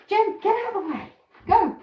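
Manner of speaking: high, loud shooing call to the hen. Real, not acted. Owner shooing her hen out of the way, high loud call.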